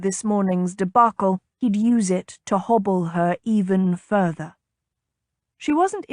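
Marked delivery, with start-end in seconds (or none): none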